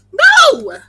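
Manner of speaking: high loud shocked no; dismayed cry. Real, not acted. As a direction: yelp in sudden dismay. Dice dropped.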